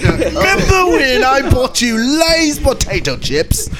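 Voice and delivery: put-on very high, slow British old-timer; hammed-up British accent, barely holding back laughter; mock British accent. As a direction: reminisce in a stretched-out, silly British voice. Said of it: "like a British accent in your, in your old age".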